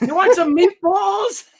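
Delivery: high, loud put-on old mother voice; pushing food like a doting grandmother; hammed-up grandma offering meatballs; mock mother.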